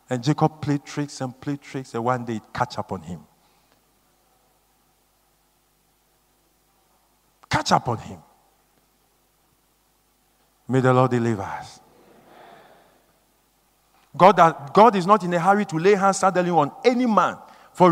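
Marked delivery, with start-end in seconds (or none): 0.1-11.8 grave preaching. Real, not acted. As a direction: sink into a solemn, prayerful close. Grave moral and prayer after outburst.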